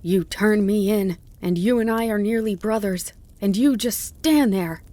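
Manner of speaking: bitter tirade building to high, loud outrage; mounting accusation. A narrator's reading. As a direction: build from contempt to an outraged cry. Fox's tirade builds against the dog.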